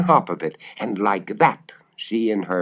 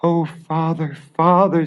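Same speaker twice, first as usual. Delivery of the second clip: put-on sobbing girl; 8 semitones higher, 3 dB louder than usual. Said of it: "sobbed Marusha".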